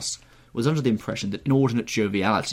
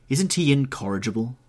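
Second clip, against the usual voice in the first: raised, amused exclamation; crying out; 5 semitones higher, 5 dB louder than usual. A narrator's reading. Dorian complaining of his witty friend, crying out. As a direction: appeal to the table in mock exasperation. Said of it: "cried Dorian".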